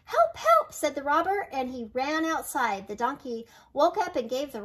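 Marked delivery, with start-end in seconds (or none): 0.1-0.7 very high, loud terrified yelp; hammed-up scared shriek; panicked cry for help. Robber bitten by the dog.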